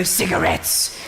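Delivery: very low, creepy put-on voice; low comic menace; mock sinister reply. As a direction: drop way down for a spooky punchline. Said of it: "I go".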